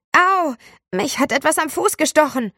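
high, loud fake yelp of pain, hammed up; crazed yell. Boy fakes a sting in class, hammed-up yell. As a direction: howl it like a madman to fool the class. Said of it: "schrie er wie ein Irrer".

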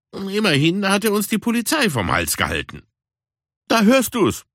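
there, you hear it; Puma voice. Acted. Puma defends Caruso. (viel höher, bestätigend)

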